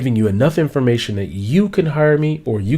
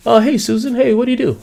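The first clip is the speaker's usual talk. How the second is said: friendly asker; 11 semitones higher, faster than usual. Acted. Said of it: "here's a lame introduction".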